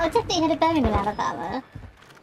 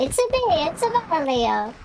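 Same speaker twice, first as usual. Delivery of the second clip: mock Italian "It's a me, it's a Mario" with added a's; a hammed-up cartoon Italian plumber; Mario impression; 4 semitones higher than usual. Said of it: "I can go".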